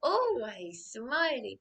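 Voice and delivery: a hint of a sugary grin, slow; slow, fake-sweet mimicry of her smile; lightly imitating the smiling woman at the desk; mock smiley receptionist. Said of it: "she's always like this".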